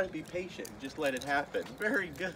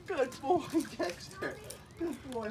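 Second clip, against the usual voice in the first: much higher squealy dog praise; excited praise; 10 semitones higher, slower than usual. Real, not acted. Owner praising puppy Dexter, high excited praise.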